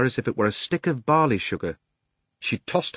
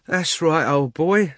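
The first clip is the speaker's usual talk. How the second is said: soothing cabby; 7 semitones higher, 4 dB louder, slower than usual. A narrator's reading. Cabby soothing his horse.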